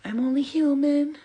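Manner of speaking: high, loud, slowed; belting declaration. Acted. Answering her critics with a loud, belted proclamation. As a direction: proclaim it like a song line.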